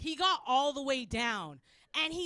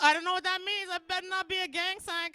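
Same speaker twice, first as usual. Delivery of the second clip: ordinary talk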